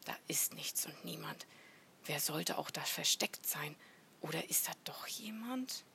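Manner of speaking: hushed, whispery musing; talking to oneself. A narrator's reading. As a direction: whisper your doubts to yourself. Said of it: "vor sich hinredete".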